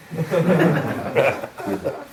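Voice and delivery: short high bashful laugh; embarrassed laugh. Real, not acted. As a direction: laugh it off like a lost man at the perfume counter. Man lost at the makeup counter.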